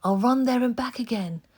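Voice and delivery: put-on a boy's high, bright promise; eager promise. A narrator's reading. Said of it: "Oliver promised".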